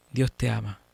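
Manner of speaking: low, heartfelt close-mic tone; reflective. Shown as a demonstration. Teacher showing how to reach the heart near the mic, reflective. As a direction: drop low and speak to the heart. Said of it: "así como más reflexivo".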